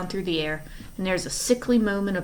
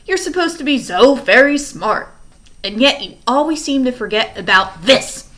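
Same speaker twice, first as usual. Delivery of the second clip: much louder, menacing villain; furious threat; 8 dB louder than usual. Acted. Stefano threatens the orphans with a knife, loud and menacing. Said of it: "red with fury".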